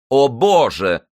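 high, loud exasperated exclamation; irritated. A narrator's reading. Zaika to the woman who tripped over the bench, irritated. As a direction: burst out in annoyance. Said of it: "раздражённо сказал Зайка".

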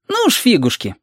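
put-on high, defiant child voice. Reshka refusing Alexander's plan, defiant child voice.

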